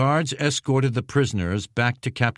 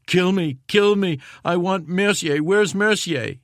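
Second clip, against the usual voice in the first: crying out for his sergeant in pain; calling out, sobbing; 9 semitones higher than usual. A narrator's reading. Dying Jackson calling out for Mercier, high pleading cries. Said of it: "continued to call out".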